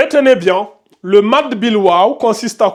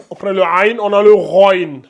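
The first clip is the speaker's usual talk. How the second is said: usual speaking voice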